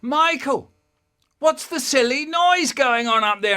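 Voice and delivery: mother shouting up the stairs; a light impression of mum calling. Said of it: "my mum from downstairs going".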